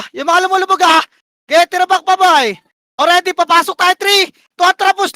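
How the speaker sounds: high, pressing battle commands; rally teammates into the attack; urgent shot-calling. Real, not acted.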